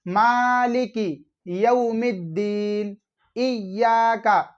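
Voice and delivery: slow मालिकी यौमिद्दीन with long vowels and clear m; drawn-out correct reading of मालिकी and इय्याका; model recitation. Shown as a demonstration. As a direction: stretch मालिकी and the doubled y of इय्याका. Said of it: "दोनों आयत को पढ़कर बताता हूँ".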